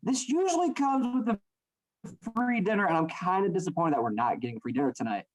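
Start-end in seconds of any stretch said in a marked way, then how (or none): none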